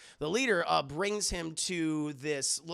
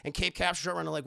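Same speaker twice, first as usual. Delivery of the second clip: ordinary talk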